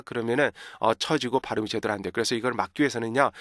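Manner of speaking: usual speaking voice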